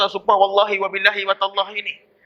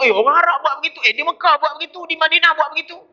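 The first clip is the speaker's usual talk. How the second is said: mock gullying voice; 6 semitones higher, faster than usual. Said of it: "Jangan tengok".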